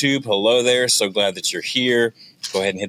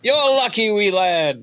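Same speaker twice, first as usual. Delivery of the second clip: mock Scottish accent; 12 semitones higher, slower than usual. Host teasing his co-host in a high mock Scottish accent. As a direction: squeak it out like a cartoon Scot. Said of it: "That's more Scottish".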